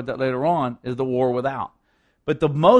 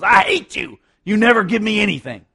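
put-on teen outburst; 5 semitones higher, 6 dB louder than usual. Teen yells at father.

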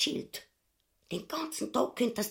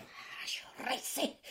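soft, whispered swear; whispered curse; 5 semitones higher, 8 dB quieter than usual. Acted. Mother Ubu, fed up with her husband, a whispered curse. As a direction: curse under your breath in frustration. Wife curses under breath.

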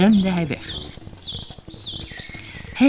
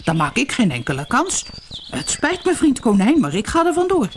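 scared leopard, voice jumping high; stammer, then flee in a panic; 7 semitones higher than usual. A narrator's reading. Frightened leopard apologizing to the rabbit, stammering high. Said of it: "stotterde het luipaard".

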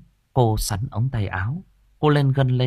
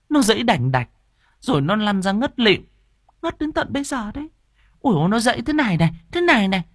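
put-on high, excited old mother's voice; agitated mother character; 8 semitones higher, faster than usual. A narrator's reading. Mother showing the visitor how he thrashed, high and agitated. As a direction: act out the fright in a raised voice.

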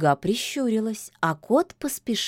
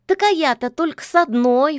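snorting retort; 8 semitones higher, 7 dB louder, faster than usual. A narrator's reading. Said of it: "фыркнул кот".

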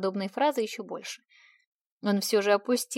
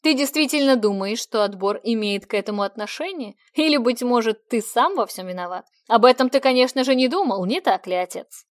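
defiant; 6 dB louder than usual. Acted. Son defies his father.

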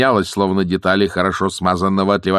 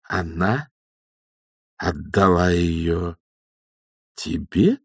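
incredulous checking back; much slower than usual. A narrator's reading. Old witch queries the young one's claim, slowly incredulous. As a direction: space it out with heavy pauses. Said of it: "переспросила матушка Буревей".

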